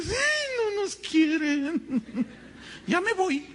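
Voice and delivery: mock the church members who feel unloved; laughing, hammed-up crybaby whine; mock whining member. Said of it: "Y algunos dicen".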